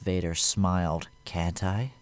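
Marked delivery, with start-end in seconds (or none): 1.3-1.9 quiet menace. A narrator's reading. Vader smiling down at the aide, soft menace. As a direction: purr the taunt.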